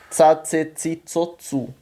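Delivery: "Tsa, tse, tsi, tso, tsu" with T plus S onset; each of "Tsa, tse, tsi, tso, tsu" starts like the zz in pizza; ts syllable drill. Shown as a demonstration. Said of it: "ele vai ter som de ts".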